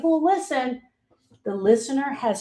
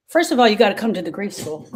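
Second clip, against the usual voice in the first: normal talking voice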